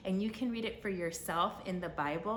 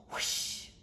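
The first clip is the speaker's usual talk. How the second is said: whoosh sound. Acted. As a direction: blow out a quick whooshing breath. Angel pops into sky.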